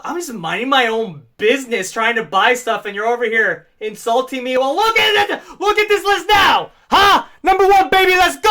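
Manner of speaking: loud, high indignant shouting; fired-up fan outburst; heated. Real, not acted. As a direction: rant that builds to a triumphant yell. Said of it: "I'm getting heated".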